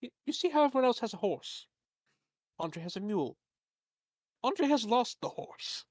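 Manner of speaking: lightly voiced fussy merchant; a hint of a fretful, rambling tradesman. Merchant NPC pleading his case.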